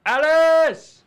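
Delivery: full-volume drawn-out call, high pitched; yelling out. Pretend. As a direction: holler the name as if across a noisy workshop. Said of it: "I yell out".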